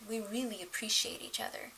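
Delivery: soft whispered sincerity; whispered, heartfelt. Real, not acted. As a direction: whisper it warmly. Tender note about her marriage.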